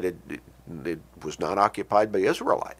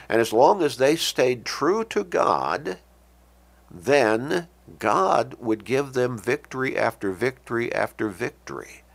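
normal talking voice

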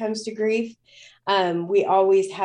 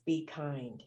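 soft, much quieter, like words heard from within; hushed inner voice; 6 semitones lower, 10 dB quieter than usual. Retold or imitated. Recalling the words she heard in her head, hushed. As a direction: drop to a hush for the voice she heard.

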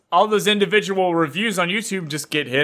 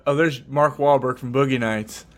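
ordinary talk, nothing marked

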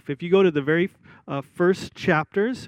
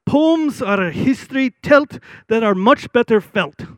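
high, loud Scottish preacher; Scottish accent; 6 semitones higher, 6 dB louder than usual. Retold or imitated. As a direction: quote it in a raised, punchy Scottish accent. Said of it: "in his Scottish accent".

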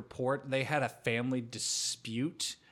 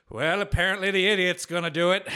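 loud, high mock of a sneering father; smug father; 6 semitones higher, 8 dB louder than usual. Dad grudgingly handing the business to his son, loud mock sneer. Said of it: "and he's like".

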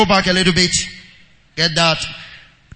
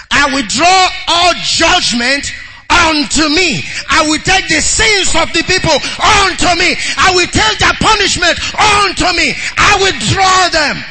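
high, forceful sermon cry; impassioned preacher voicing Jesus; full-force preaching; 7 semitones higher, 4 dB louder than usual. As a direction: proclaim it to the whole congregation.

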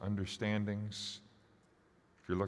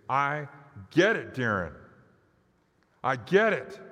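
loud soapbox ranting; 5 semitones higher, 6 dB louder than usual. Pretend. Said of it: "you would say".

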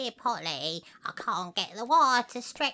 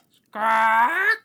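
louder squawk like a bird; loud parrot squawk; 11 dB louder than usual. Acted. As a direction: squawk back at her.

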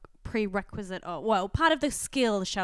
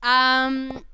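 talking around a sip of juice; while sipping a drink; 3 semitones higher, 7 dB louder than usual. Real, not acted. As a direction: speak just after a slurp. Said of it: "that's really annoying".

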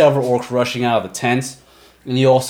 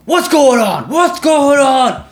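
much higher, louder, slow alarmed yell; orcs yelling; 5 dB louder, slower than usual. Acted. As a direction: bellow it across the camp. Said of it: "You hear the orcs begin to yell".

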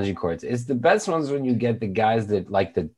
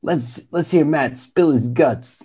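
game show host voice; 6 semitones higher, slower than usual. Retold or imitated. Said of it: "And he's like".

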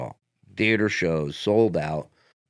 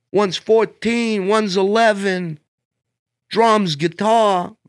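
Dice voice; 11 semitones higher, 4 dB louder, slower than usual. Retold or imitated. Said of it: "He goes".

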